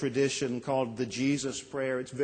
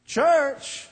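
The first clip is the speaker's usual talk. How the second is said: scoffing; 11 semitones higher, 7 dB louder than usual. Retold or imitated. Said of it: "and he said".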